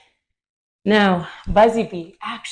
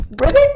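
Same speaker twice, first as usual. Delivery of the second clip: high, loud cartoon ribbit; frog croak; 14 semitones higher, 8 dB louder than usual. Shown as a demonstration. Class jumps like frogs.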